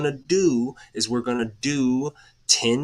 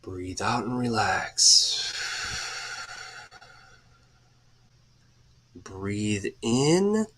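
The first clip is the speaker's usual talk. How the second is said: guided breathing; 3 semitones lower, much slower than usual. Acted. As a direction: ease the listeners through each breath, unhurried. Leads a guided breathing exercise.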